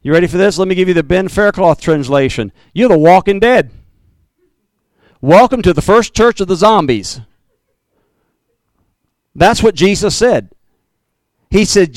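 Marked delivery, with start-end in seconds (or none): none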